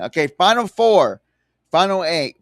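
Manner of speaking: normal talking voice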